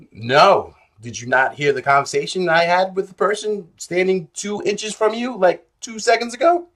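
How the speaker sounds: exasperated retort. Retold or imitated. Refusing a pushy line buyer.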